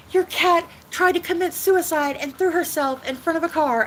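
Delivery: neighbors quoted. Retold or imitated. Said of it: "they're like".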